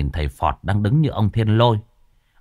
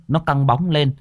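plain reading voice

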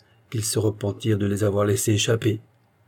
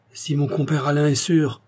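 ordinary delivery

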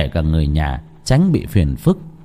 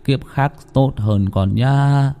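mournful prayer; 5 semitones lower than usual. A narrator's reading. Old man praying for the dead baby, low and sorrowful. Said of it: "Lầm rầm khấn".

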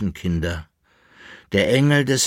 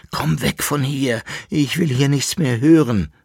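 crying out; 5 semitones higher, 4 dB louder than usual. A narrator's reading. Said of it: "rief der Jäckele-Narr".

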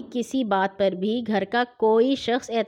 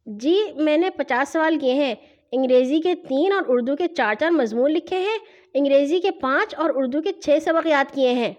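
put-on child's higher-pitched rote report; machine-like recital; 4 semitones higher than usual. A narrator's reading. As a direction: rattle off the list like a machine. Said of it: "میں machine کی طرح بول اٹھا". (Machine کی طرح رٹا رٹایا)

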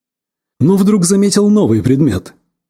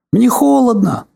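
higher whimpering little voice; whiny squeak; 7 semitones higher than usual. Pretend. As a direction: whimper it like a toy. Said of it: "пища и хныкая". (писк с хныканьем)

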